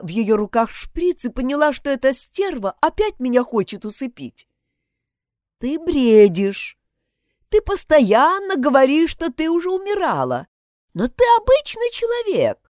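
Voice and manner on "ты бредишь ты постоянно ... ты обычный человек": with a smile. Acted. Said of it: "с улыбкой сказала женщина".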